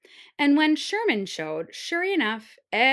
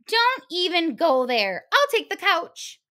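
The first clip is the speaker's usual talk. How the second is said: put-on bossy big girl; 7 semitones higher, 5 dB louder than usual. Big girl bossing the hares.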